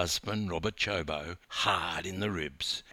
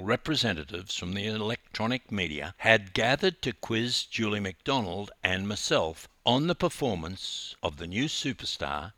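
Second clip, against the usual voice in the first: ordinary delivery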